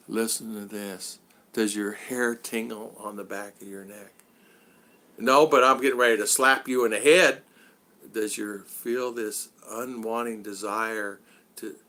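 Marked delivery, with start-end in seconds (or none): 0.1-4.1 slow, low, hushed ASMR parody; mock ASMR. Streamer imitating J G's tingle video, mock ASMR. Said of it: "no S A G M or what's that". 8.1-11.8 low, soft, drawn-out ASMR parody; mock ASMR. Streamer copying yesterday's J G stream, mock ASMR. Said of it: "I'm listening to J G's yesterday".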